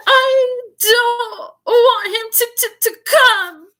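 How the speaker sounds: very high, loud, slow pretend sobs; fake sobbing yell. A narrator's reading. Dudley faking sobs at mother, high wailing. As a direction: wail it like a spoiled child faking tears. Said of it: "yelled between huge pretend sobs".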